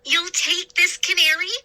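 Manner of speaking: high, hopeful disbelief; hardly daring to believe it; amazed boy. A narrator's reading. Boy checking the pirate really accepts the bird, high hopeful voice.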